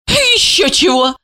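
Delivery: high, scornful woman's retort; dismissive high exclamation; snorting dismissal. A narrator's reading. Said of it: "фыркнула Ася".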